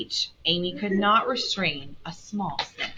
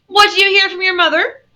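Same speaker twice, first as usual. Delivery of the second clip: loud, pitched-up gruff demand; gruff old lady; 13 semitones higher, 9 dB louder than usual. A narrator's reading. Old aunt questions her niece, loud and gruff. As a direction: ask it brusquely, at raised volume. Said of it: "asked the old lady gruffly".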